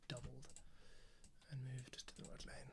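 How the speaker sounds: trailing into a soft whisper; whispered aside. Real, not acted. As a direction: murmur it to yourself as you work. Quiet aside while editing.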